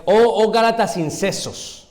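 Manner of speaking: high, slow exclaimed rebuke; exclamación. Shown as a demonstration. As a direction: cry it out, stretching the words. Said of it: "está exclamando". (exclamando, agudo y alargado)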